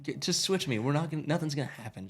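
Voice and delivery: pleading coax. Retold or imitated. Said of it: "I'm like".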